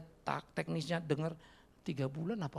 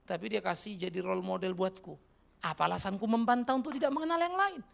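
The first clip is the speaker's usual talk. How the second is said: ordinary talk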